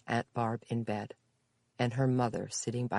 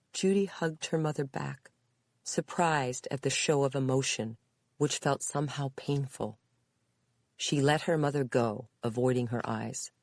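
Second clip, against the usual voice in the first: plain reading voice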